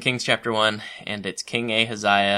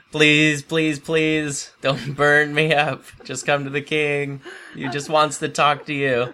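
mock begging; 5 semitones higher, 4 dB louder, slower than usual. Third captain crawling, begging mercy.

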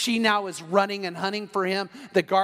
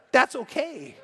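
indignant retort; 6 semitones higher, slower than usual. Retold or imitated. Husband mocking wife's reply in his head, indignant. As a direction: throw it back with wounded pride.

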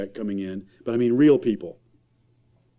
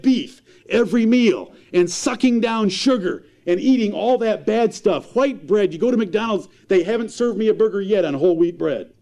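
raised, high-pitched pulpit preaching; fiery preaching; 8 semitones higher, 3 dB louder than usual. Acted. Preacher scolding parents' priorities, impassioned and raised. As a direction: preach it hard to the congregation.

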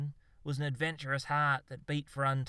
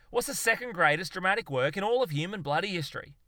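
loud, high, insistent question; intense quizzing; 7 semitones higher, 6 dB louder, faster than usual. Acted. Zoologist quizzes his slightly terrified mate, intense. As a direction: demand the answer with mounting zeal.

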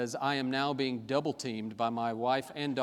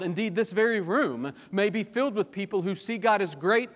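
louder, driving sermon delivery; fervent pulpit preaching, raised and urgent; preaching at full force; 5 semitones higher, 5 dB louder than usual. Acted. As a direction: press the point hard on the room.

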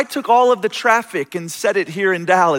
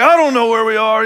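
raised, frantic lost driver; exasperated caller; 4 semitones higher, 4 dB louder than usual. Retold or imitated. Caller lost on the highway, raised and exasperated. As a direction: sound lost and fed up. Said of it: "lots of curse words".

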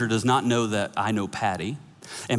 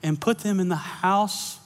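earnest preaching; 5 semitones higher, slower than usual. Acted. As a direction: lean into the point and slow down. Preaching climax, slow.

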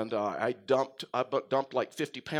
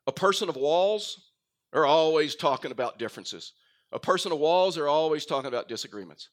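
louder, driving repetition; preaching; 2 semitones higher, 5 dB louder than usual. Acted. Pastor building to a forceful point for his church, louder. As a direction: pound each line home.

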